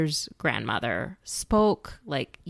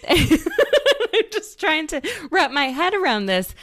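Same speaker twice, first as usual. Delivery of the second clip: laughing through the words, pitched up; 12 semitones higher, 5 dB louder than usual. Real, not acted. Cohost laughing at reality TV spin-offs, laughing. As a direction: giggle while trying to get the words out.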